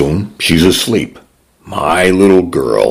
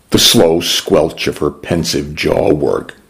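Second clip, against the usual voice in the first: ordinary delivery, nothing marked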